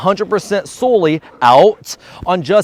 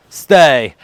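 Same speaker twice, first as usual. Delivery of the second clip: usual speaking voice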